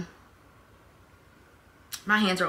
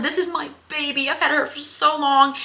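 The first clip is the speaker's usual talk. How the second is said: higher, beaming answer about the necklace; delighted; 8 semitones higher, 3 dB louder than usual. Retold or imitated. Answering a nice lady about her necklace, higher and fond. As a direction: lift the voice, proud and fond. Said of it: "I said".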